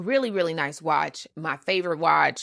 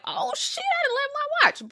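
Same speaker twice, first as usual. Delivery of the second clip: sudden realization; 3 dB louder than usual. Retold or imitated. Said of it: "I'm like".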